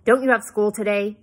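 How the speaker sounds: loud, harsh question. A narrator's reading. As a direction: throw the question at her roughly. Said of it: "he asked harshly".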